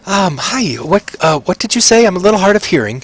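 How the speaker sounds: high-pitched, hard-of-hearing little woman; flustered greeting in a high character voice; flustered halfling mother. Acted. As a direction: play a startled mother who missed what was said. Startled mother greets unexpected visitor.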